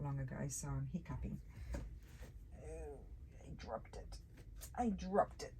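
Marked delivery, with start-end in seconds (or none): 2.6-4.2 breathy whisper to herself. Real, not acted. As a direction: murmur it under your breath. Drops something, mutters to self.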